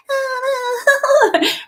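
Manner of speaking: long, high, wordless whine; hammed-up complainer's wail on a held high note; mock wail. Imitating employees still resisting the easier way, mock wailing.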